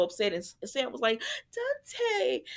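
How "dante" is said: high, soft plea of his name; girlfriend's high plea; pleading call. Retold or imitated. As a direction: call him back, pleading. Sam calling Dante's name.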